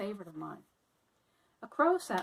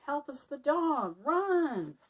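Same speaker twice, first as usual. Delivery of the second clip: loud, higher, panicked cry for help; frightened cry; 6 semitones higher, 6 dB louder, much slower than usual. Acted. Mice fleeing the angry dog, panicked shout. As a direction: yell for help as the dog charges. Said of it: "ran with fright".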